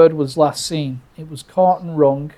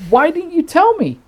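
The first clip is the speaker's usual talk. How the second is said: high-pitched exasperated complaint; indignant protest; 13 semitones higher than usual. Retold or imitated. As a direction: demand it, incredulous and high. Indignant protest at the two guys.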